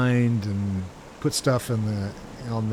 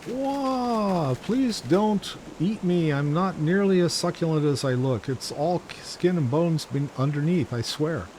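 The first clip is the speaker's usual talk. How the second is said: high, frightened begging voice; scared pleading; 5 semitones higher than usual. Acted. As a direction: beg not to be eaten. Voicing a frightened game character.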